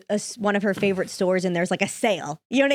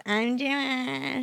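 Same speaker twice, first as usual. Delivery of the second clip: put-on whiny girl voice; 4 semitones higher, 5 dB quieter, much slower than usual. Shown as a demonstration. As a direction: whine it like a spoiled girl. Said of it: "a whiny girl's voice".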